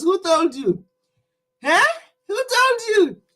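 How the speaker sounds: ordinary talk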